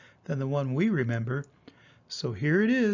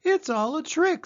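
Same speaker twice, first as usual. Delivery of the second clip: much higher, scornful little monster; high child voice; 11 semitones higher, 4 dB louder than usual. Acted. Child scoffs at the tales, much higher. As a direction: dismiss it high and sure.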